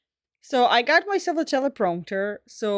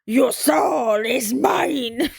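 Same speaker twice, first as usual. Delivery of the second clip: very slow villain line, cracking up; villain impression breaking into laughter; 4 dB louder, much slower than usual. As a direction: try to stay sinister but laugh through it.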